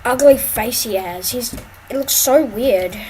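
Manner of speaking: ordinary delivery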